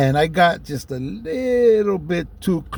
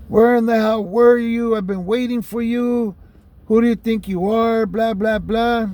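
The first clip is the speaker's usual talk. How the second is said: angry mom yelling; 6 semitones higher than usual. Retold or imitated. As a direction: tear into him like a furious mother. Mom yelling at late son.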